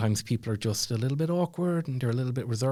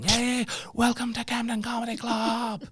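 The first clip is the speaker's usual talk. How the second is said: much higher, bursting with excitement; high energy MC welcome; 13 semitones higher than usual. Retold or imitated. MC jumping on stage to grab the crowd, high excited welcome. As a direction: bound on stage and hype the crowd. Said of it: "real high energy stuff".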